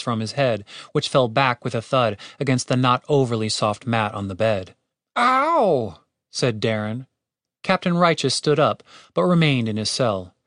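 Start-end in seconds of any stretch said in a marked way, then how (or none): 5.2-6.0 high, drawn-out ow; pained yelp. A narrator's reading. Guard whose head hit the bed, pained yelp.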